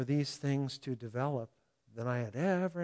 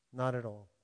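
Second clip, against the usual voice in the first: ordinary delivery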